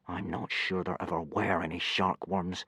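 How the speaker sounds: low-volume muttering through gritted teeth; Hiccup, gritted teeth. A narrator's reading. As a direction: say it quietly through clenched teeth. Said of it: "said Hiccup through gritted teeth".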